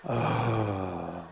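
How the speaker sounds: hammed-up man's orgasm noises; mock male climax. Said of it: "That's not attractive".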